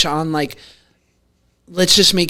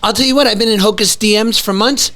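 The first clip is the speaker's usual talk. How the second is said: usual speaking voice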